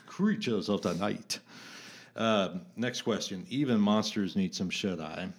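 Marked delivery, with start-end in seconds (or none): 0.0-1.4 mock Eastern European horror-film accent; creepy old-movie Transylvanian accent; Bela Lugosi accent. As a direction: do a hammy vampire accent with rolled-out vowels. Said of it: "And well, in the accent".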